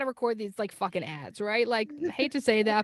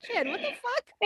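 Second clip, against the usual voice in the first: higher, slow mock-incredulous; amused disbelief; 8 semitones higher, slower than usual. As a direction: stretch it out in playful disbelief. Reacting to Jen's apology.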